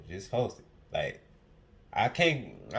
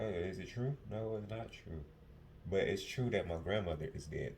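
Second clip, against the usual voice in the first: subdued after crying; 4 semitones lower, 9 dB quieter than usual. Real, not acted. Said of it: "I got emotional".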